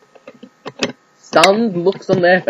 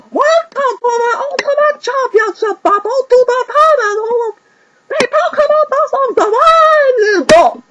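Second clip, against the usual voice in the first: stadium announcer; 5 dB louder than usual. Acted. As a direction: open the show to the crowd. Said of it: "he announced".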